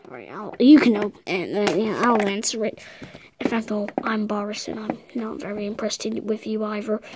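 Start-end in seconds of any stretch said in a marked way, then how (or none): 3.4-7.0 hushed whisper; secretive toy whisper. Acted. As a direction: say it under your breath. Toy Boris speaks secretly.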